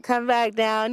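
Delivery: ordinary talk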